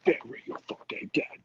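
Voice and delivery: hushed, voiceless mock metal scream; soft, raspy fake scream; scratchy scream imitation. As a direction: imitate a metal vocalist under your breath. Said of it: "make a bunch of scratchy noises".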